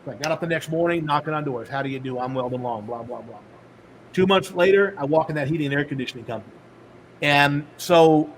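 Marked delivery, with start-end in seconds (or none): 1.7-3.4 quieter, tossed-off routine pitch; rote spiel. Retold or imitated. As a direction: rattle it off like the hundredth door. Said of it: "I'm like".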